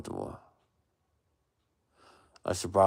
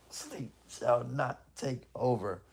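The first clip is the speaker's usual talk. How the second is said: solemn vow; 3 semitones higher, 6 dB quieter, slower than usual. Real, not acted. Dramatic vow.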